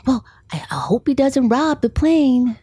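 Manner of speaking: a shy little whisper. Retold or imitated. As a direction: son repeating it under his breath. Child repeats remark quietly.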